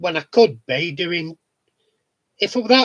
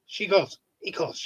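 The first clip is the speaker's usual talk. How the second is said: ordinary delivery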